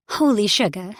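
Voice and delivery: a hint of Southern twang, surprised; slight southern accent. A narrator's reading. Redhead meeting another human, surprised, slight Southern accent. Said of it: "in a slight southern accent".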